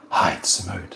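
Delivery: impression of the viewer Simone, low and hushed. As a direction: drop low and soft, playing Simone. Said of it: "I'm gonna do the impression".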